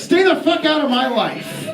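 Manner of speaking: high, slow, emphatic yell; slow defiant shout. Acted. Comedian tells bureaucrats off, slow shouted defiance. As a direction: spell it out slowly to the bureaucrats. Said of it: "I say to these bureaucrats".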